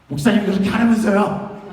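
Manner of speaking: acted-out outraged comeback; indignant protest. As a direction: throw it back at the pastor, loudly. Acts youth protesting to the pastor.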